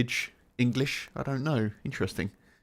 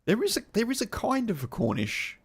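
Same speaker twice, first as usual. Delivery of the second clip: raised pitch guessing accent; 9 semitones higher than usual. Real, not acted. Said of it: "trying out the accent he hears".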